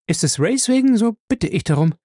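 viel höher, eilig und besorgt; Ella drängt, schnell zu erfahren, was mit Ray ist; hastige junge Frau. Acted. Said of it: "antwortete sie hastig". (Ella fragt Johnson besorgt nach Ray, hastig und hoch)

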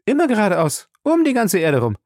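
high-pitched, breezy reveal; excited announcement. Acted. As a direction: announce an outlandish plan with gusto. Passepartout announces the world tour.

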